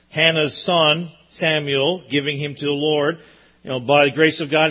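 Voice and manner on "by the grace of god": ordinary talk, nothing marked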